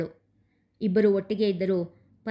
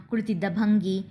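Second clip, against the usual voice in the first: ordinary delivery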